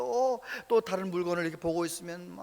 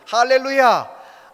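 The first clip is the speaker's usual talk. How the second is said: slow, loud, stretched hallelujah; drawn-out hallelujah; 5 semitones higher, 13 dB louder, much slower than usual. Real, not acted. Pastor crying hallelujah to the congregation, slow and loud. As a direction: lift the hallelujah out long.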